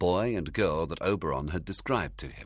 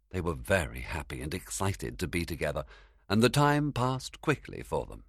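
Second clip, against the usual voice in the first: ordinary delivery, nothing marked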